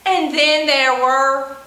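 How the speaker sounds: bouncy, sing-song counting rhyme with whoops; playful children's finger-play chant; storytime action rhyme. Acted. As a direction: chant it for little kids doing the actions. Chants five perky penguins rhyme.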